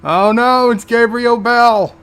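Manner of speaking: loud, high and slow "oh no!"; a real viewer's shocked cry; alarmed outburst. Real, not acted. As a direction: gasp out loud at the screen. Viewer reacts to the fight.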